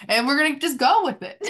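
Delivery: a bright burst of cheer; laughing-edge excitement; bubbly resolve. Real, not acted. Cheerful resolve about the car.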